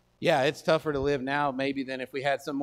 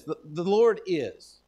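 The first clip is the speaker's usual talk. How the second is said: "The Lord is" drawn out slowly, with a pause after "is"; slow, pausing emphasis; 3 semitones higher, 5 dB louder, slower than usual. Shown as a demonstration. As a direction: hold on "is" and let it hang. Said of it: "Let me just show you this".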